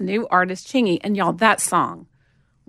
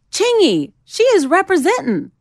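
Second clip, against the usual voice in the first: high, loud imitated hype call; impressed exclamation; 11 semitones higher, 6 dB louder than usual. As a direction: call it out loudly like a hype man. Imitates group member exclaiming at her.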